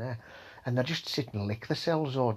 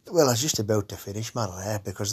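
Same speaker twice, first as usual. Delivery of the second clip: ordinary talk, nothing marked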